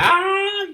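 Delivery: high-pitched imitated scream of pain; mock scream. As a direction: shriek like a villain being crushed. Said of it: "Frieza's just screaming for his life".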